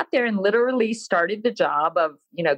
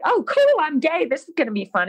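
much higher, bright "Oh, cool" full of glee; mock cheerful delight; 8 semitones higher, faster than usual. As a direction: sell it like wonderful news.

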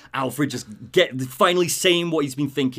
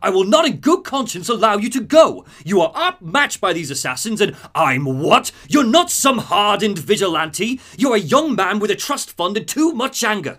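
firm, loud, higher protective butler; Alfred pleading loudly; 8 semitones higher, 7 dB louder than usual. Retold or imitated. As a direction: stand your ground and raise your voice. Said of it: "And he goes".

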